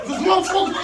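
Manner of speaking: high, strained exasperation; exasperated disbelief. Retold or imitated. To his roommate about the kiss, high exasperated complaint. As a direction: squeeze it out in disbelief. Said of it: "And I was like".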